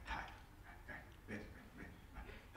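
hushed, unvoiced soothing words; consoling whisper. An older actor hugging a weeping Paresh, soft whisper. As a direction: whisper him gently to sit and settle.

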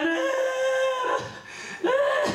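very high, thin panicked whimper; terrified squeal. Retold or imitated. Boy locked in the toilet squealing in terror. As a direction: squeal like a cornered kid.

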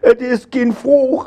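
high-pitched, whining lament; wailing complaint. A narrator's reading. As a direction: wail it like a thrashed boy. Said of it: "Herr Hülden".

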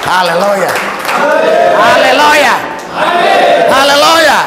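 high, loud hallelujah shout to the crowd; rousing revival-preacher cry; rallying shout. Real, not acted. Preacher rallies congregation for amens.

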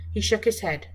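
ordinary delivery

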